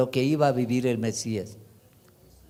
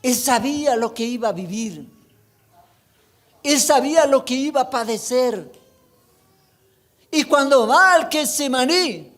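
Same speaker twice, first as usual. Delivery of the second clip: full-force preaching; 11 semitones higher than usual. Acted. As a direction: preach it with fire to the congregation.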